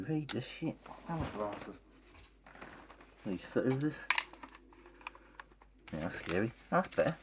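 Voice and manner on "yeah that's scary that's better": ordinary delivery, nothing marked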